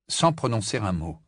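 usual speaking voice

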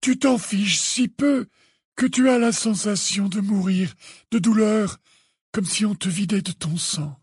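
put-on high, measured old headmaster; calm, almost detached. A narrator's reading. Dumbledore naming Harry's pain, calm and detached. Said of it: "très calme, presque détaché".

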